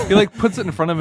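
fast and excited telling; rushed. Real, not acted. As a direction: blurt it out quickly.

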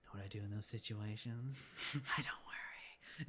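soft, half-whispered imitation; hushed retold quote. Acted quote of the movie character.

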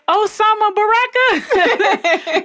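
slow, high, laughing imitation; laughing mom quote. Confused mom to nurse, slow high laughing. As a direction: stretch it out high, amused. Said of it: "then she would say".

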